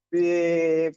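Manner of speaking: slow drawn-out beep like a held horn; mock horn blast, long and slow; imitated horn. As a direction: stretch the beep out like leaning on the horn. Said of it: "like I was shouting at the person".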